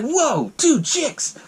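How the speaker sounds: slow, high-pitched mock amazement; thrilled dude. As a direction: stretch it out, gawking with excitement. Retells dude thrilled by two women.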